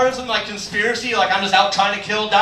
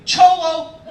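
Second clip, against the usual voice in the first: loud, high pool-game shout; imitated kid's call, pitched up; answering call; 8 semitones higher, 5 dB louder than usual. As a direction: yell it back like a kid playing. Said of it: "the other responded with".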